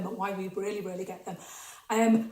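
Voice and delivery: ordinary delivery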